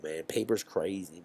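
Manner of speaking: ordinary talk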